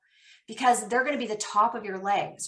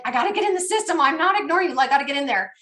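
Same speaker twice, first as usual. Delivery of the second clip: imitating an eager, higher-pitched rush to get in; louder, higher mock urgency; urgent FOMO; 4 semitones higher, 5 dB louder, faster than usual. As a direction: voice her fear of missing out. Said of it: "she felt like the fomo".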